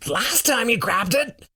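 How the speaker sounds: high-pitched panicked argument; frightened insistence. Acted. Roar pleading in high fear.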